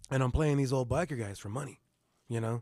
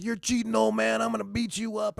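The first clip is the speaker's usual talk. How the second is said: angry accusation; 9 semitones higher than usual. Retold or imitated. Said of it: "I'm like".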